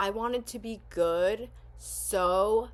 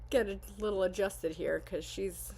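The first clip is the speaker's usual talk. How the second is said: ordinary delivery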